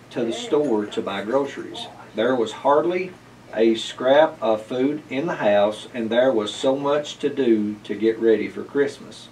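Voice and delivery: plain reading voice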